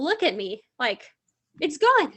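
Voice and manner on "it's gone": higher, louder mock protest; imitating a put-out boyfriend; imitated boyfriend's complaint. Imitating boyfriend's complaint at vanished text.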